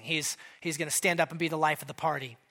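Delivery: ordinary talk, nothing marked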